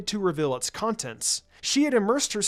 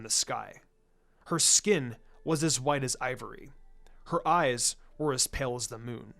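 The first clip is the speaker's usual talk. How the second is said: solemn legend reading; 4 semitones lower than usual. A narrator's reading. The narrator reads Hinata's scroll fable, lower and solemn. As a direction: intone it like an old myth from a scroll.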